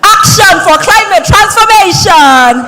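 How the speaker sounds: rally the audience with energy; hype shout. Real, not acted. MC hyping the crowd.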